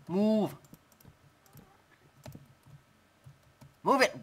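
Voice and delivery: high, loud exasperated shout; yelling move. Real, not acted. Gamer yells at his wolf blocking him, loud shout. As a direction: shout her out of the way.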